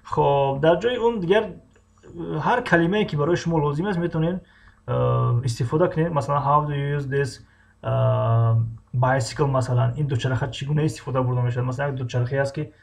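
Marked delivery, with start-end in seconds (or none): none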